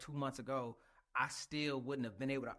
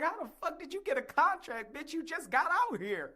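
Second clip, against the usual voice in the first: mock exasperation; 11 semitones higher, 3 dB louder than usual. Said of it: "I'm like".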